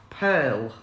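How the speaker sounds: ordinary talk, nothing marked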